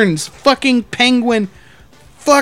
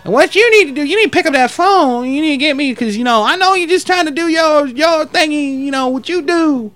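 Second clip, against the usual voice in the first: higher-pitched put-on drunk complainer; imitated drunk man; 5 semitones higher than usual. Drunk man cornering the manager about money, higher-pitched impression. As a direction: mimic a drunk old man demanding help. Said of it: "he kept talking like that dude".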